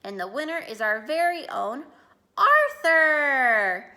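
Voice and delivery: winner announcement. A narrator's reading. Principal announcing the contest winner to school, building announcement.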